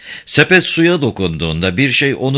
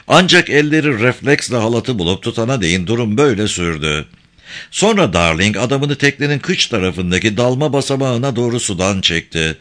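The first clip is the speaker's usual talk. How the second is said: plain reading voice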